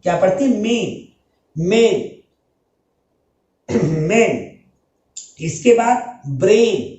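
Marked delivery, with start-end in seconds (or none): 0.6-2.1 मेन with a long ay; long ay in main. Shown as a demonstration. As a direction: stretch the vowel of मेन. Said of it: "हम इसको पढ़ते हैं मैन". (मेन को लंबी ए से)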